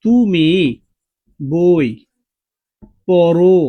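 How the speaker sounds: slow and loud, stretching the ও of পড়ো; slow example sentence. Shown as a demonstration. Teacher showing the ও ending to students, slow and loud. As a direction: say তুমি বই পড়ো word by word, holding the final ও of পড়ো. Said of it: "এবার দেখো".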